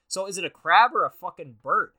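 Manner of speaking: loud, incredulous demand about crab or bird; exasperated rant. Real, not acted. Reading his horoscope app, yelling at its nonsense, loud exasperated. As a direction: shout the question in disbelief.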